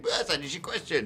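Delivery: mock pleading plug. Mimicking their own community posts.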